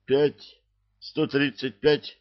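high, slow astonished count; shocked counting; surprised counting. A narrator's reading. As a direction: blurt the huge number in disbelief. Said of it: "удивлённо считали они".

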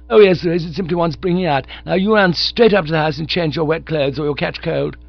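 high, fast and loud; motherly bossy young woman. Acted. Honoria plans to reform Bertie, high and bossy. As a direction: take him in hand like a governess.

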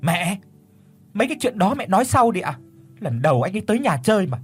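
high, fast, frowning snap; snapping. Acted. Daughter snaps at her mother to stop, high and fast. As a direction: cut your mother off sharply. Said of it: "cau mày rồi gắt lên".

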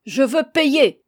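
yelling. A narrator's reading. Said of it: "hurlait de plus belle".

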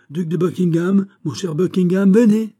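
high, loud call, building to a shout. A narrator's reading. King at the window summoning the duke, shouting. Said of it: "cria-t-il".

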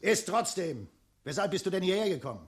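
loud fast questioning; loud fast pressing. Acted. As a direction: push him hard, quickly. Dragon presses the wanderer.

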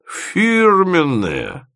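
high, long-drawn falling crow; gleeful. A narrator's reading. Drunk man identifies the whisky, gleeful drawl. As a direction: stretch the word out with tipsy glee. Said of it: "залился жизнерадостным смехом".

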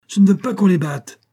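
high, loud, pouting protest; childish pout. A narrator's reading. Mercedes stops Hall beating the dogs, childishly indignant. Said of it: "s'écrie-t-elle avec une moue enfantine".

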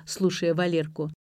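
plain reading voice

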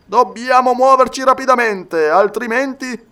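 loud, high-pitched urging; urgent warning. Acted. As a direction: raise the voice to press for action. Soldier urging immediate action in council. (esortazione urgente, forte e acuta)

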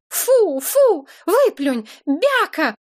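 high, slow coaxing scold; scolding a puppy. A narrator's reading. Woman scolding the puppy to spit out her SIM card, high slow scold. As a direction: drag out the words to make the dog let go. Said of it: "увещевала я щенка".

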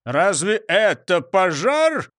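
slow, scornful grumbled question; grumpy and dismissive; grumbling. A narrator's reading. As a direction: stretch it out in disgruntled disbelief. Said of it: "проворчал он".

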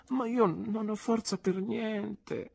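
put-on high, slow girlish whine; Dora petulant. A narrator's reading. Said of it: "disse Dora scuotendo i riccioli". (lamento capriccioso, voce alta e lenta)

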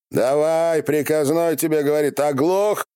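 high, sustained commanding shout; barked order. A narrator's reading. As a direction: shout down at him impatiently. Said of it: "прикрикнул Ярыг с коня". (властный окрик)

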